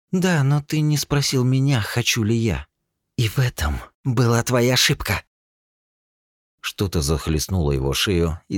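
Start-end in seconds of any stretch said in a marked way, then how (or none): 0.1-5.2 cold accusation. Acted.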